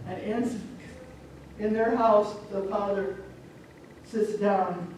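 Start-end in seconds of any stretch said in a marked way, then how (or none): none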